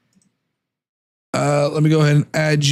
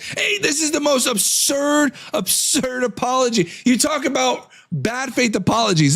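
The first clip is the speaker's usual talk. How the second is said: exasperated; 7 semitones higher than usual. Real, not acted. Scoffing at the apology.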